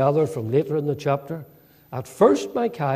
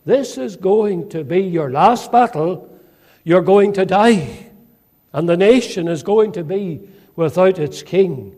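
loud, raised prophetic warning; grave pronouncement; 4 semitones higher, 6 dB louder than usual. Acted. Prophet warning King Ahab of death, forceful pronouncement. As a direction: proclaim the doom forcefully, higher and louder.

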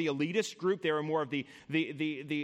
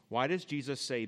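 ordinary talk

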